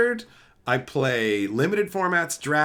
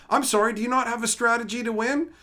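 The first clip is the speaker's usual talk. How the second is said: ordinary talk, nothing marked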